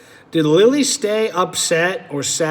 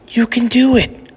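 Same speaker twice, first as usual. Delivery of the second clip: higher, bright reassurance; encouraging; 6 semitones higher, 4 dB louder than usual. Acted. Lily encourages frozen Ginger at the aisle, higher and cheering. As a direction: cheer a scared friend on.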